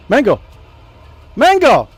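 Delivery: loud high calls, rising each time; calling the cat. Real, not acted. Streamer keeps calling the kitten, loud high calls. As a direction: call the cat harder and harder.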